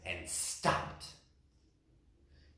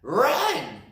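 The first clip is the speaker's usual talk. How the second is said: high, loud urgent shout; high loud cry to run; 9 semitones higher, 7 dB louder than usual. Acted. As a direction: yell it at the others. Rabbits urging to flee.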